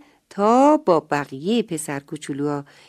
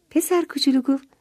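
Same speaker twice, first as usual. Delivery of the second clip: plain reading voice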